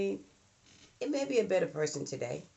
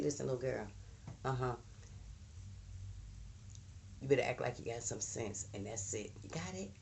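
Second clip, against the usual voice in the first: low, softer stern talking-to; firm hush-voiced discipline; stern quiet warning; 3 semitones lower, 9 dB quieter than usual. Parent warning a misbehaving child privately, softer and stern.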